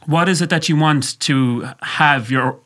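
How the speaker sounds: ordinary talk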